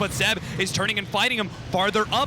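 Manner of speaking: fast, rising esports casting call; hyped play-by-play. Acted. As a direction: build the hype as the fight breaks. Live play-by-play excitement.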